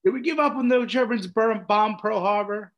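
high, loud rabble-rousing rant; rally the frat like Bluto; imitating a drunk pep-talk rant; Bluto speech. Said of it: "the Bluto Animal House".

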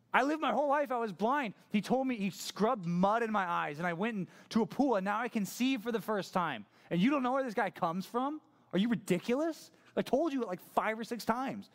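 high, emphatic exasperated rant; sassy, worked up. Acted. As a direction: spell it out for them in disbelief. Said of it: "sassy man gets really into it".

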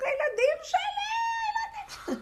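high-pitched wail of a panicking mother; hammed-up terrified shriek; mock screaming. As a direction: imitate a hysterical scream, stretched and piercingly high. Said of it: "והאישה התחילה לצעוק".

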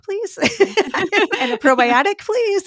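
high amused ordering with laughs; new mom ordering formula, high and giggling; laughing. Retold or imitated.

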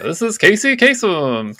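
slow, high, loud radio ID; Casey Kasem. Acted. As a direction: draw it out like a countdown host. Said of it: "kind of voice".

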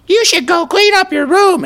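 mocking mother voice. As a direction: imitate a mother as a terrible witch. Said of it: "and you make a voice like that".

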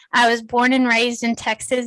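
ordinary talk

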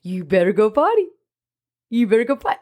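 playfully hound a stranger like a dog owner; mock nagging. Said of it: "I'm gonna be like".

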